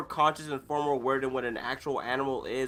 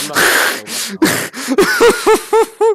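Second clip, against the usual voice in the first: much higher, louder, slow stretched wail; high drawn-out whine; 16 dB louder, slower than usual. Pretend. Repeats refusal, drawn out.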